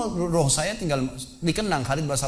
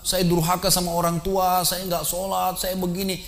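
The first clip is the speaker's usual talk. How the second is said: higher, pleading imitation of a penitent; trembling tearful confession; 5 semitones higher, slower than usual. Retelling a shaken volunteer confessing sins to the preacher, imitated tearful pleading. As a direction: imitate a weeping confession, pitched up and drawn out.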